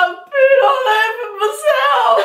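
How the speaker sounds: high, slow, drawn-out sobbed confession; mock crying wail. Confessing an accident to a lover, exaggerated tearful wail. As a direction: wail it in hammed-up tears.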